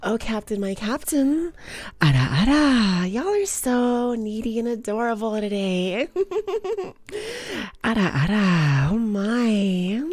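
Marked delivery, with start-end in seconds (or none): 2.0-3.1 slow, louder teasing big-sister voice; teasing ara ara. Pretend. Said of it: "wanting these ara aras". 7.8-10.0 louder teasing big-sister voice; teasing ara ara. Pretend. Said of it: "wanting these ara aras".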